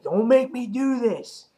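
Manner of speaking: high, strained mock threat; hammed-up comic-book ultimatum; threatening Cyclops. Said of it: "he keeps threatening him".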